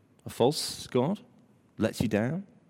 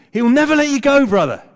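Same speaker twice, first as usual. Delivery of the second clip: louder, high-pitched preaching straight at one listener; fervent, full-voiced encouragement to a brother; impassioned assurance; 10 semitones higher, 8 dB louder, faster than usual. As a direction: drive the promise home personally. Promise repeated to named listener.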